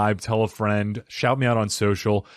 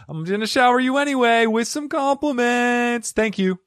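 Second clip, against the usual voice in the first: silly high voice; 11 semitones higher than usual. Pretend. High silly shower of compliments.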